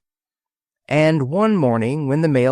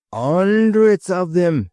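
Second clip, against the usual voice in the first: Cockney sparrow; 6 semitones higher, 4 dB louder, slower than usual. A narrator's reading. Cheapside voiced in Cockney.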